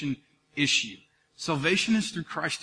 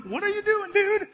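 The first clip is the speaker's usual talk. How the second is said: high, strained, exasperated call to a lazy buddy; mock exasperated; 12 semitones higher, 5 dB louder than usual. Pastor replaying yelling at friend Doug at camp, high and exasperated. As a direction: act out the impatient planner snapping at his friend. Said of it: "I was like, mad at him".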